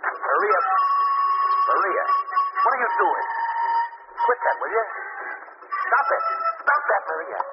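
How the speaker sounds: high, alarmed pleading cries. Acted. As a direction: beg her to stop, rattled. Husband alarmed at strange dancing.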